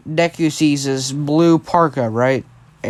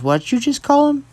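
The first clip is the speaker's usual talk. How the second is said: high-pitched, incredulous retold question; puzzled question; 11 semitones higher than usual. Bakugo questions Kitsuki about the name, puzzled high question. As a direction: query it in disbelief, pitch climbing high.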